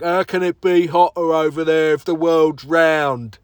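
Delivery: slow, loud mock of a know-it-all; imitating Justin. Mocking his co-host Justin's theories in a slow loud voice. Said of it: "That's how he talks".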